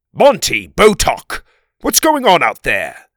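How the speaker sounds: thundering king's call; booming voice. A narrator's reading. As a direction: boom it out from inside the house. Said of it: "came a booming voice from within".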